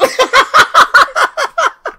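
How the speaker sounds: wheezy run of high laughter; high helpless laugh. Real, not acted. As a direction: burst out laughing. Laughs at the fake account.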